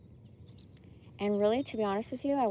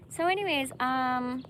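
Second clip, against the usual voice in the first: chirpy high hello to viewers; perky and loud, greeting the camera; bright greeting; 6 semitones higher, 5 dB louder than usual. Real, not acted. Cheerful vlog greeting to viewers.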